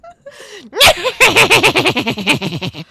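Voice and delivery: high loud witch cackle; scary wicked witch laugh; evil cackle. Shown as a demonstration. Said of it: "Ooh, that was kinda scary".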